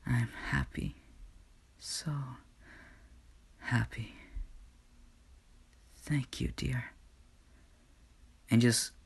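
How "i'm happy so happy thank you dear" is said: much softer, fading last breath; faint dying words. Acted. Inko to God with her last breath, faintly. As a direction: let it slip out as she fades.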